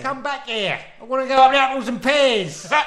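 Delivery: high, loud comic Cockney; Cockney shark. Acted. Shark ordering the swimmer back, Cockney shark voice. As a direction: call after them as a Cockney shark. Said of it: "Yeah, it's a Cockney shark".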